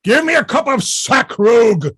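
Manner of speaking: loud, high bellow; shouting. Acted. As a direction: roar for a drink. Falstaff shouting for drink.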